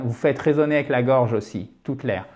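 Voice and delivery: ordinary delivery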